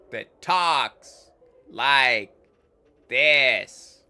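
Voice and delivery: slow, loud, dragged-out mockery; mocking stone voice. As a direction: ham up the statue's slow voice. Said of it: "not another Burke that talks like".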